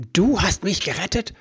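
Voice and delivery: high, loud, rising in disbelief; angry elf throwing her words back at her; incredulous shout. A narrator's reading. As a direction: yell the question back at her. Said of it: "schrie er".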